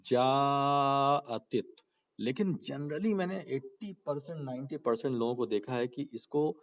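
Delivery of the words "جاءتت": جاءتت with the jaa stretched very long; long madd. Shown as a demonstration. Teacher repeats the written example, very long jaa in جاءتت. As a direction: hold the first vowel steady, then close on attit. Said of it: "یہ لکھا ہے".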